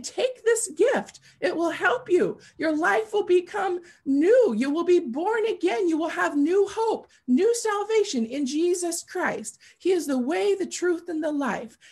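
high, loud pleading cry of the gift-giver; urgent calling out. Acted. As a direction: shout it after someone running away, begging them to take it. Voicing someone chasing, urging.

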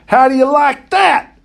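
high, taunting holler; gloating yell. A narrator's reading. Boy taunting the monkey he just hit, gloating yell. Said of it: "I yelled at him".

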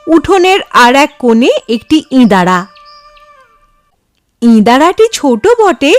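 usual speaking voice

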